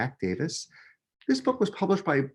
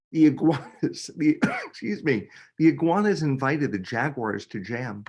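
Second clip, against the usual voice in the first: ordinary delivery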